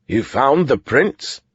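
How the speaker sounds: slow, wondering question; a touch of disbelief; surprised. Acted. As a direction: caught off guard by the news. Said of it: "said with surprise".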